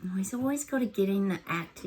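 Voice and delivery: ordinary delivery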